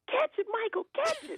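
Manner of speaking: high-pitched mock yell, no extra volume; retold shout. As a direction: call out urgently in a high voice, re-enacting it. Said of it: "I'd be shouting".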